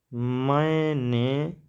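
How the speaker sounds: मैंने stretched out very slowly; drawn out so students can write it down; slow dictation. Shown as a demonstration. Teacher dictating मैंने slowly while writing on the board. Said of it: "यहाँ लिख देते हैं थोड़ा सा".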